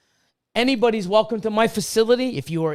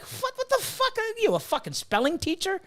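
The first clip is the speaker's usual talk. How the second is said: incredulous outrage; 11 semitones higher, 3 dB quieter than usual. Real, not acted. Mocking Facebook grammar trolls.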